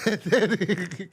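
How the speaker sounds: laughing through the words; slow laughing words. Real, not acted. Telling the ending while laughing.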